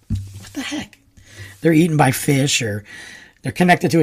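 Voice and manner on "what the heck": high whispered what the heck; much higher, whispered aside; self-mocking whisper. Real, not acted. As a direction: whisper it at your own silly idea. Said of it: "Not sharks".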